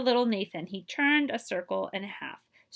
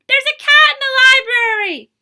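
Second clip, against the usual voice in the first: much higher, loud alarmed shout; 17 dB louder than usual. Acted. Someone at story hour spotting the cat, loud high shout. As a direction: yell the alarm across the room. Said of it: "Someone shouted".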